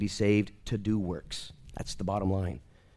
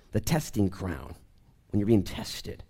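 normal talking voice

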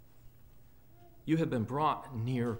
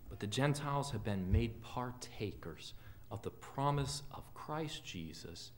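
normal talking voice

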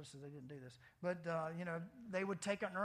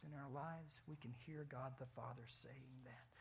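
near-whisper, intimate and soft; hushed sermon; 3 semitones lower, 8 dB quieter than usual. Real, not acted. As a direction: lean in and hush the room.

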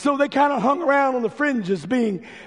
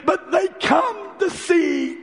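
preaching at full force; 4 semitones higher than usual. Acted. Preacher building to the congregation, full preaching force. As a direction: drive the point home from the pulpit.

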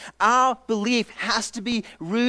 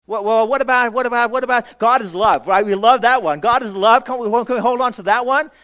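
louder, higher, eager pleading to keep a verse; pleading congregant; 3 semitones higher, 7 dB louder than usual. Retold or imitated. A congregant begs Paul to keep a favourite verse, loud and eager. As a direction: ask loudly and eagerly, begging to hold on to it.

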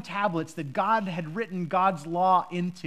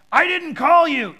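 put-on loud, slow, grumpy old man woken at night; grumpy Eli; 8 semitones higher, 9 dB louder, slower than usual. Eli grumpily tells the boy he did not call, loud and slow. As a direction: snap it out like a grumpy old priest dragged out of bed. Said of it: "a very grumpy voice on Eli".

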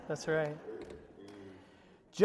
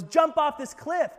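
mock taunting Satan; 7 semitones higher, 8 dB louder than usual.

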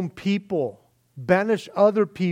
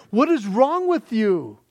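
exasperated rebuke; 6 semitones higher than usual. Retold or imitated. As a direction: scold them in disbelief. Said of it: "Can you imagine this young man just going".

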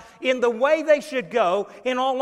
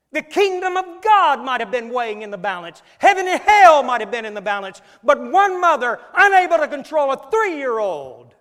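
loud, raised sermon delivery; forceful preaching; 4 semitones higher, 11 dB louder than usual. Real, not acted. Preacher warning what one mother put at risk, forceful preaching. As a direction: preach it with full weight on the stakes.

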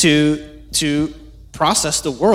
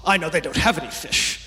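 mocking laugh. Shown as a demonstration. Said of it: "Jesus isn't patronizing them".

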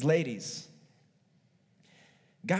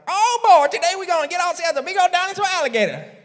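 Cajun impression; 13 semitones higher, 12 dB louder, much faster than usual. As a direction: ham up a thick Cajun accent, much higher and quicker. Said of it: "he'll turn to the camera and say something like".

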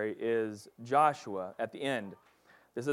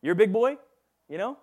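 mock dismissive; 8 semitones higher, 5 dB louder than usual. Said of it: "He's like".

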